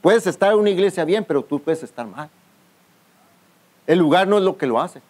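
ordinary talk, nothing marked

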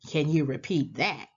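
plain reading voice

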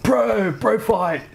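high, excited bro shout. Retold or imitated. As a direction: call out a bro fight like a dude. Cells voiced as bros.

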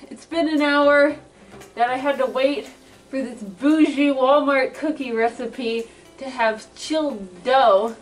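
normal talking voice

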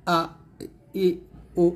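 "আ", "ই", "উ" each said short, cut off quickly; short quick vowels. Shown as a demonstration. Teacher showing students quick harakat reading, short separate "আ ই উ". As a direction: say "আ ই উ" as three brief separate vowels. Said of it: "হরকতের উচ্চারণ তাড়াতাড়ি পড়তে হবে".

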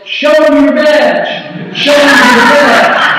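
high, drawn-out shout across a field; acted yell. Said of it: "and he yells".

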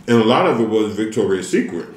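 words around food; talking while chewing. Real, not acted. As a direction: mouth partly full of rice. Seen 1: Forkful of rice eaten at -2s; mouth works on food while talking.

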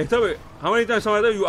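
ordinary talk

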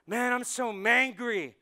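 loud, drawn-out comic grumble; mock angry complaint. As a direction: ham it up and stretch the words. Acts out invented word for Monday anger.

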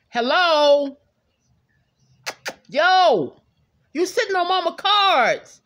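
loud, high exasperated call. Real, not acted. Telling the cat to get off her cards, loud high call.

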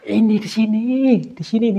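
high-pitched, startled insistence; urgent pointing out. Real, not acted. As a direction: urgently show them where it is.

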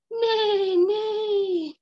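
upset toy horse; crying neigh. Acted.

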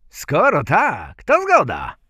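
loud, high-pitched assent; agreeing thieves. A narrator's reading. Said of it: "odrzekli złodzieje". (złodzieje, głośno i wysoko)

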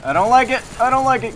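high, loud panicked cry; panicked shout. Real, not acted. Cornered by an enemy in the match, a high panicked shout. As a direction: scream it as you're cornered.

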